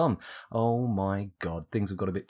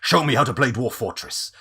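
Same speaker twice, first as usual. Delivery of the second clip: louder, lightly voiced kidnapper; 2 semitones higher, 8 dB louder than usual. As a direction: growl it like a thug holding a gun. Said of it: "someone saying".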